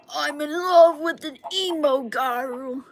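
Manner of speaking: a hint of schoolyard mockery; mocking bully voice. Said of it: "sing that song to me in, like, a really fucked up voice".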